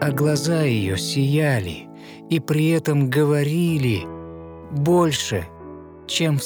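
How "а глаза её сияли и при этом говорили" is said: plain reading voice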